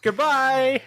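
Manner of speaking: high, silly sign-off voice; squeaky goodbye. Real, not acted. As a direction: chirp it out high and goofy. Sign-off in a put-on voice.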